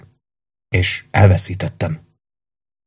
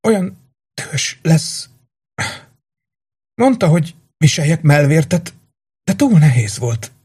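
fading dying woman; 8 semitones higher than usual. Acted. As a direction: drift away mid-sentence. Haldokló lány utolsó szavai. (magas, lassú, elhaló sajnálkozás)

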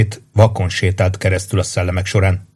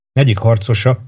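ordinary delivery, nothing marked

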